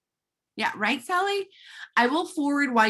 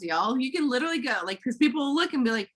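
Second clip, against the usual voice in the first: usual speaking voice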